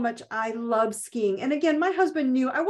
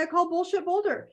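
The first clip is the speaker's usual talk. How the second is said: defiant declaration; 6 semitones higher than usual. Shown as a demonstration. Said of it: "Clap it and say".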